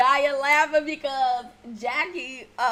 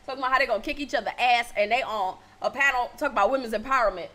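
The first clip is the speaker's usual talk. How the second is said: normal talking voice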